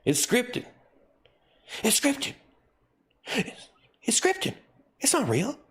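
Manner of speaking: high squeaky joking voice; silly clown. Pretend. Mock silly repeat.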